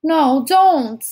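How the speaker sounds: high, loud startled protest; frightened cry. A narrator's reading. Startled child pushes a hand away, frightened high cry. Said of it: "she cried out".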